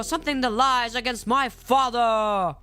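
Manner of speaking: Worf's challenge. Retold or imitated. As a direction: proclaim it like an offended Klingon warrior. Acts out Worf's defiant challenge.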